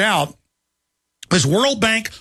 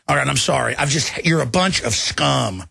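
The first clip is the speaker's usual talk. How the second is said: normal talking voice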